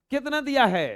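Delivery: loud mock yell; shouting girl. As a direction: yell it like a fierce little girl. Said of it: "वो एकदम चिल्ला के बोल रही थी".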